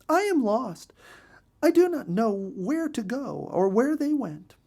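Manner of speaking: high, light bird voice; a small lost bird asking politely; lost bluebird asking for help; little bluebird. A narrator's reading. Lost Betty explains.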